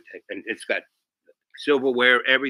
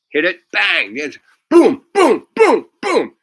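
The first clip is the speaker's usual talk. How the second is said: loud, high whooping booms; hammed-up hospital-bed celebration; excited cheer; 10 semitones higher, 12 dB louder, slower than usual. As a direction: cheer it like a jackpot. Porn on the hospital TV.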